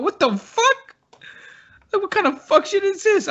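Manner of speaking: very high-pitched disbelief; mock alarmed outrage. He reacts to someone busting his door, mock alarm. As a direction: reenact outrage in a squeaky-high voice.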